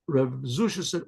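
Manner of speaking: ordinary talk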